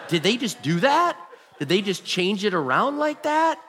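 can't believe what just happened; astonished disbelief. Retold or imitated. Pastor stunned the council changed its mind: high incredulous.

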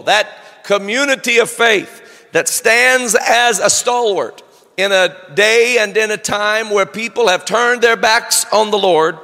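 ordinary delivery

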